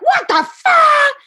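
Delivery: high, drawn-out exasperated outburst; mock blow-up. Wife shows the outburst she held back, exploding. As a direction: act out losing it, each word stretched. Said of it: "and blowing up".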